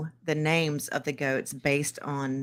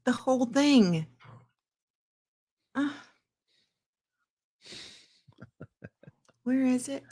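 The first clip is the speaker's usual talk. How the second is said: high, whiny exasperation; exasperated; 8 semitones higher than usual. Real, not acted. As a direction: complain in a high put-upon voice. Exasperated complaint to viewer.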